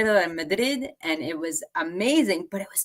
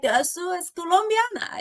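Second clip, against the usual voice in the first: high Spanish-speaking waiter; 12 semitones higher than usual. Retold or imitated. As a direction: voice the waiter, surprised. Waiter voiced in story.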